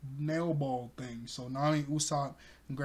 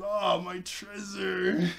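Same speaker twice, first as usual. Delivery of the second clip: slow mock lament; 4 semitones higher, much slower than usual. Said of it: "but she says".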